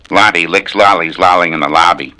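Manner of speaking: usual speaking voice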